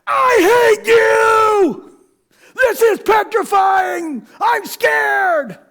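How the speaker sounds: very high, loud, drawn-out screaming; terrified scream. Retold or imitated. Wife screaming at husband in the dark coaster, terrified. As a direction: scream it in real panic on the ride. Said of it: "sitting next to me screaming".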